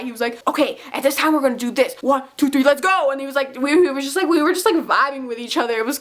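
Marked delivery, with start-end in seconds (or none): 0.5-3.1 imitating a hype guy's count-in; rally the group, building to the last words. Hype guy counting fans in, hyped-up. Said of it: "I called him the hype guy".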